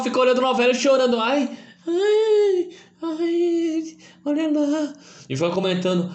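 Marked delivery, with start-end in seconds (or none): 1.2-4.9 high fake sobbing; mock crying voice. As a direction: whimper like a crybaby. Mocking a crying novela watcher. (voz chorosa de deboche)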